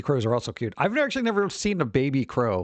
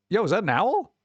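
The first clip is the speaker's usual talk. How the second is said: ordinary delivery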